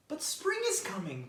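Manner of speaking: bright little bird cheering a friend; chirpy bird. Acted. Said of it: "Bird chirped".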